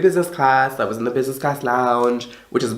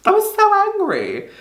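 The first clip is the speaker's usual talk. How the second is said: high squeak. Real, not acted.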